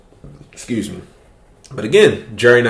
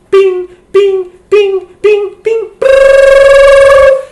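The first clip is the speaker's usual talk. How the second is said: high-pitched imitated notification dings; ping it like a phone blowing up, then a buzz; 7 dB louder, much slower than usual. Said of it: "All you hear is".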